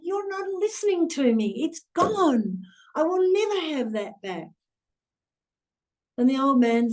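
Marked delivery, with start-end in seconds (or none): 0.0-4.5 imitating a grieving man losing patience; distraught young man. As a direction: pitch jumps much higher, anguished insistence. Retelling grieving young man's despair.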